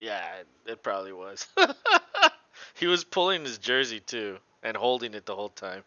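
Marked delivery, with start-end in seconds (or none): 1.5-2.3 sudden high burst of laughter; a loud high laugh breaking out. Real, not acted. Laughing out loud at the game on screen.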